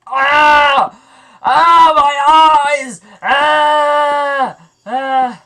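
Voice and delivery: loud, high cries at the glare; pained screaming. Real, not acted. Player screams as a bright light blinds him.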